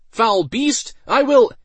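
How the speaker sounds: high, loud battle cry; heroic shout. A narrator's reading. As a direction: shout at the beast. Mage shouting at monster.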